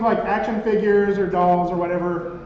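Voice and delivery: ordinary talk, nothing marked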